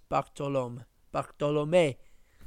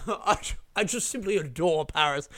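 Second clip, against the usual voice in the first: pretentious posh traveller. Pretend. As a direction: play a smug rich tourist, lightly. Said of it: "What a fucking pretentious thing to say".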